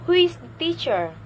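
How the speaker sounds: loud high start, falling on "teacher"; question-word intonation. Shown as a demonstration. Teacher modelling a question with question words, falling on "teacher". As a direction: hold "Who is" high, then drop "teacher". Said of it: "horizontal line and then rising".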